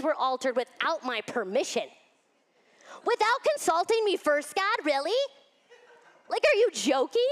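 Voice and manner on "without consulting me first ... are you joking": higher, louder disbelief at God; exasperated. Pretend. Teacher voices frustration at God changing plans, exasperated. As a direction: throw up your hands in disbelief.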